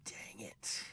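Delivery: tiny unvoiced curse; whispered mock dismay. Sweeney realising he killed his wife, whispered dismay. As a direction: whisper it in understated defeat.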